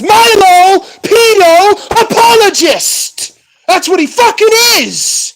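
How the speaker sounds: loud, high, slow angry yell; furious shout. Real, not acted. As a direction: bellow his name with outrage. Furious rant about Milo.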